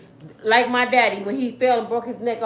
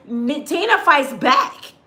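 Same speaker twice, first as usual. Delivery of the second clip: ordinary talk, nothing marked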